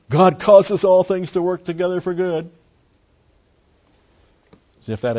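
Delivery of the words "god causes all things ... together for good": high, loud, whiny mock quote; mocking whine. Preacher mocking believers who whine scripture in chaos. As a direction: sneer it as the whiners do. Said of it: "And then they whine".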